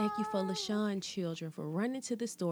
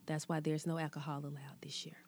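quick hushed aside; fast whisper; 4 semitones lower, 6 dB quieter, faster than usual. Acted. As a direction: rush it out in a whisper. Gossip aside during prayer.

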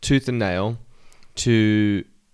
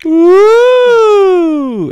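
high, loud, long teasing ooh; exaggerated nineties sitcom crowd ooh; sitcom audience ooh; 12 dB louder than usual. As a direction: whoop like a studio audience at a kiss. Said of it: "when two characters kiss".

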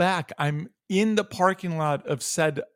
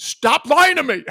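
sudden loud, high snap of anger; furious outburst, much higher and louder; retold angry shout; 10 semitones higher, 7 dB louder than usual. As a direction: yell it at the call center agent. Retold angry call with agent.

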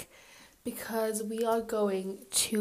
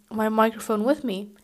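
ordinary talk, nothing marked

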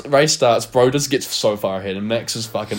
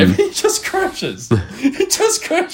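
very high, slow disbelief; stretched-out, high-pitched incredulity; incredulous; slower than usual. Retold or imitated. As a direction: squeal it slowly in astonishment. Incredulous retelling of the crash.

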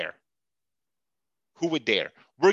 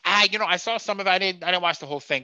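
fast, dodging excuse; evasive fast mumble; 3 semitones higher, faster than usual. Retold or imitated. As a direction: rush the excuse out. Daryl's evasive reply.

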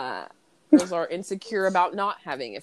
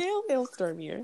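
fake news voice; 3 semitones higher, 7 dB quieter than usual. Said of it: "she acts all fake on the news".

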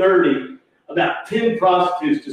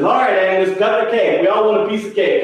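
higher, insistent host; impatient urging; 7 semitones higher than usual. Retold or imitated. Harry hurries Agnes with the cake, raised insistent voice. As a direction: imitate hurrying someone along.

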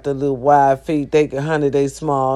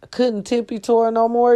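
normal talking voice

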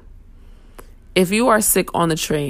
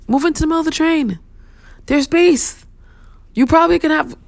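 exasperated outburst; 11 semitones higher, 3 dB louder than usual. Real, not acted. Exasperated commuter rant.

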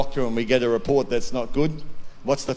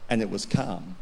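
quiet hush; 7 semitones lower, 7 dB quieter than usual. A narrator's reading. Storm stills, voice drops.

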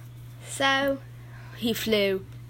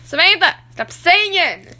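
shouting; 10 semitones higher, 7 dB louder, much slower than usual. Acted. Kid shouting at singing Bob.

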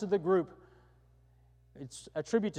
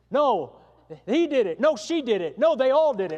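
louder, hammed-up finger-pointing; mock bickering; 7 semitones higher, 10 dB louder than usual. Preacher mimicking politicians blaming each other, loud mock bickering.

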